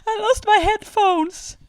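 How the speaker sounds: high, slow wailing mock sob; mock crying. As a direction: imitate a friend crying over a trifle. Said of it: "they found her crying".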